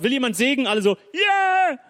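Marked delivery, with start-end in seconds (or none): 0.0-0.7 calling out. Retold or imitated. Preacher calls out to crowd. 1.1-1.8 high, loud whoop of the crowd; mock crowd cheer. Preacher mimics churchgoers cheering for blessing, high loud whoop.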